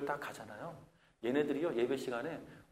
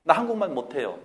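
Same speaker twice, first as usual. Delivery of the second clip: put-on defiant teen; 10 dB louder than usual. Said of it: "혼내려고 그러면".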